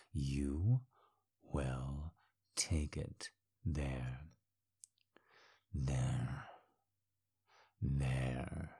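soft, slow commanding murmur; low murmur. Acted. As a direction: breathe the order low.

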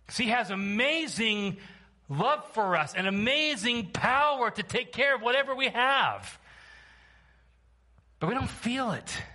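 high-pitched, forceful pulpit delivery; impassioned preaching. Real, not acted. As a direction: exhort the church with sustained intensity.

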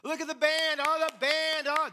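loud, high hammed-up gushing; mock fan. Congregant fawning over the worship band, mock gushing. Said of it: "that's not what we're doing here".

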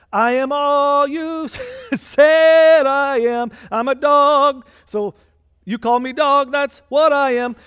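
loud, slow, high declaration of humble faith; bold loud reply. Retold or imitated. Preacher voicing the Canaanite woman answering Jesus, loud and high. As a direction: proclaim it loudly, slowly, like a joyful concession.

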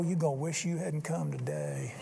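muttered dare to the devil; a quiet menacing thought; inner threat. Retold or imitated. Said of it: "and I'll just think".

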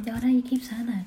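whispering. Real, not acted.

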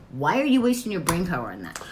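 ordinary talk, nothing marked

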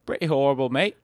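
badly faked English accent; English accent attempt. Co-host asked for his English accent, sounding Australian, mock English. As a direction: have a poor go at an English accent. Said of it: "How's your English accent?".

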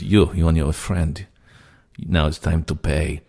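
put-on slow Russian accent, demanding. Retelling the prank caller demanding money, slow Russian accent. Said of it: "this very convincing accent".

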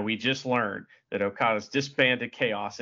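ordinary delivery

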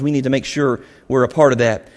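ordinary talk, nothing marked

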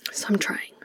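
soft breathy whisper under the breath; whispered aside. Real, not acted. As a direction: let it slip out as a hushed whisper. Quiet aside about names.

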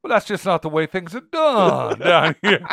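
slow honeyed Southern drawl; Southern lawyer voice. Retold or imitated. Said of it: "And I always think of it as like".

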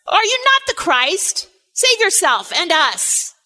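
a taunting, railing criminal, pitch flung up high on the question; mocking criminal. Criminal railing at Jesus on cross.

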